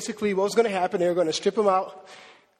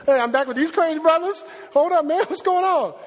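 loud, high, hyped-up hollering; excited high greeting; 8 semitones higher, 4 dB louder than usual. Acted. Paul hollering at his brothers, loud and high. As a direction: call out to the crew like a hyped friend.

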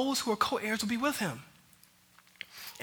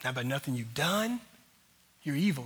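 usual speaking voice

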